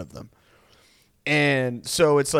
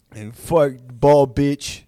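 put-on slow, loud fighter's accent; Nate Diaz's accent; 4 dB louder, much slower than usual. Fighter rebuffing his boss, defiant in his own accent. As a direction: spit it out slowly in the fighter's accent. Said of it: "but just in that accent".

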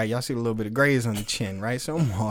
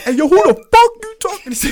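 laughing, much higher and louder, hammed-up fury; mock outrage; 11 dB louder than usual. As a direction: fake a furious comeback through laughter. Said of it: "I wanted to be like".

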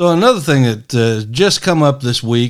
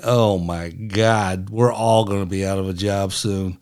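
imitating panicked staffers, lower and slowed right down; mock despair; 6 semitones lower, 6 dB quieter than usual. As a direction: moan it out like a doomed staffer. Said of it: "they're just saying".

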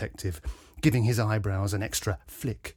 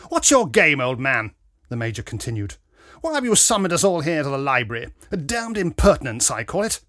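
loud, higher, blustering military man; indignant Major; 4 semitones higher, 7 dB louder than usual. A narrator's reading. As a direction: demand answers with outraged bluster. Indignant Major challenges the detective.

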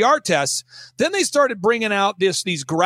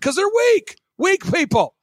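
scornful retort; 12 semitones higher, slower than usual. Retold or imitated. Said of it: "I'm like".

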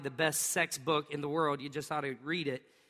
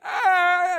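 a loud, much higher scream; imitated child scream; 13 semitones higher, 11 dB louder than usual. Dad acting out his injured son's scream, high and loud. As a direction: wail it like a hurt kid. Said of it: "with a blood-curdling scream".

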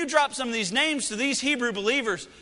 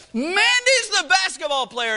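proud parent; 8 semitones higher, 5 dB louder than usual. Retold or imitated. Parents bragging about older sister.

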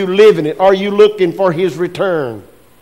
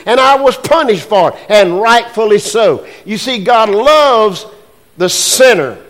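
raised, louder preacher's voice driving each line; fervent preaching; 5 semitones higher, 7 dB louder than usual. Acted. Pastor preaching sin and punishment, louder and raised. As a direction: preach it hard to the pews.

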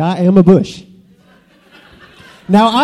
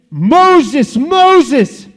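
booming voice; 4 semitones higher than usual. Acted. God calls Moses from the bush, booming voice. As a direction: boom the name out grandly. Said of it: "booming voice, and it said".

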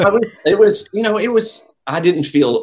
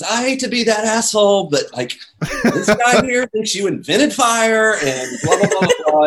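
Kelly persuading Paul to come meet the narrator; Kelly pleading to Paul; 11 semitones higher, 4 dB louder than usual. Retold or imitated. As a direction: sell him on the superfan, pushy but polite. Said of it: "she goes".

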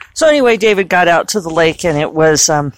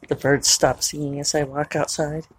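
ordinary talk, nothing marked

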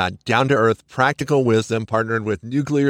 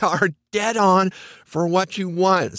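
ordinary talk

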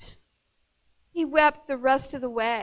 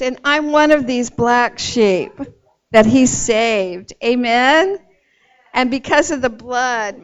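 loud, ringing preacher's cadence; full-voiced sermon delivery building to amen; preaching; 8 dB louder than usual. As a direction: preach it out to the congregation.

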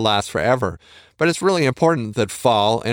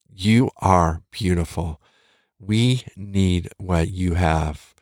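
slow, sincere and tender; slow, earnest reassurance to a doubting listener; heartfelt affirmation; 4 semitones lower, slower than usual. Real, not acted. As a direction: say it like you truly mean it to them. Speaker affirms listener directly.

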